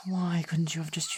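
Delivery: hushed, despairing aside; exasperated whisper. Acted. Friend scolding quietly as doom approaches, whispering. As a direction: hiss the question so no one hears.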